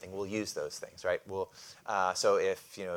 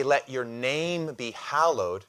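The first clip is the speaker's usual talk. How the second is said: slow, deliberate recitation; slow prayer words; 3 semitones higher, 5 dB louder, slower than usual. Shown as a demonstration. Preacher quoting the prayer to the congregation, slow. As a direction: let each word sit, prayerful. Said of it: "so when we say".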